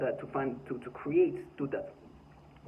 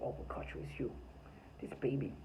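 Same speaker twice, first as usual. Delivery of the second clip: hushed mocking whisper of a taunt; mock whisper, voiceless; 10 dB quieter than usual. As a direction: breathe the taunt out, soft and sneering. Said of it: "they're like".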